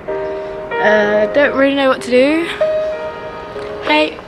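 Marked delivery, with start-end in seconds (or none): none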